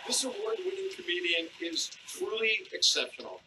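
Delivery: high, booming show announcer calling names; announcer intro. Acted. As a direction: hype up the crowd like a club MC.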